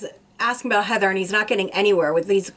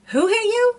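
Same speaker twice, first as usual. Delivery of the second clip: much higher, sharp disbelieving question; mock the detective's exasperated disbelief; imitating a frustrated detective; incredulous demand; 13 semitones higher, 3 dB louder than usual.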